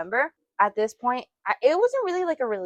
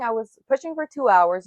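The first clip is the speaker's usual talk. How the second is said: ordinary delivery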